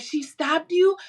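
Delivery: high, loud retold disbelief; shocked. Friends learn she was stabbed, mock shock. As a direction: imitate friends asking in shock.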